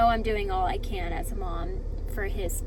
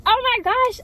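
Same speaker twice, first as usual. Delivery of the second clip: much higher, much louder thrilled gasp; overjoyed flattered exclamation; delighted squeal; 13 semitones higher, 10 dB louder than usual. Retold or imitated. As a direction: squeal it like you just won. Delighted at second compliment.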